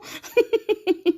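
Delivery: falling giggle; high laugh. Real, not acted. Host laughing with viewers, high giggle. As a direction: laugh along. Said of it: "ça la fait trop rire".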